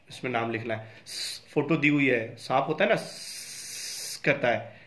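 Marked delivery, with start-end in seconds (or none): 3.0-4.2 voiceless sss like a snake; teacher's demo snake hiss. Shown as a demonstration. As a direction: hiss softly to show the sound. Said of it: "that's called hissing".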